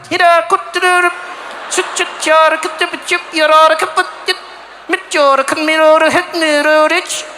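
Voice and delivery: high, loud, curt mock Swedish gibberish; hurried mock Swedish. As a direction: rattle off short Swedish-sounding syllables against the cold. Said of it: "you can't mess around out there".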